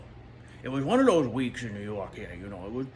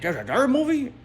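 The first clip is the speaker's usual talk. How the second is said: high, loud disbelieving question; hammed-up surprise; incredulous; 11 semitones higher, 6 dB louder than usual. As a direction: shoot the pitch up in mock astonishment. Acts out surprise at a sequel.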